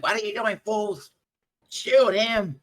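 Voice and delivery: high-pitched goblin scolding, rising to a shout; angry goblin boss. Acted. Goblin boss ordering the wolves about, angry yelling. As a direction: berate the underlings, high and loud. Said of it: "he starts pointing at the wolves".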